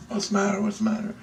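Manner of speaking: very high, thin mimicked question; high-pitched imitation of concerned bystanders; worried onlookers. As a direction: pipe up as a fretting crowd. Crowd's alarmed question acted out.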